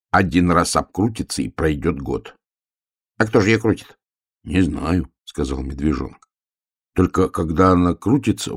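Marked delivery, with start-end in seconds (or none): 2.8-4.0 higher little hedgehog voice; lightly voiced, pitched-up hedgehog; puzzled hedgehog. A narrator's reading. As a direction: ask as a puzzled small hedgehog. Hedgehog questions bear in story.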